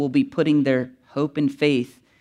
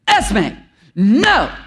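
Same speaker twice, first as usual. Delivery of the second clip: put-on sharp, loud, stern warning to a baby; stern yell; 7 semitones higher, 6 dB louder than usual. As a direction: snap the name, then a loud firm no. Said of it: "And my wife just turned and goes".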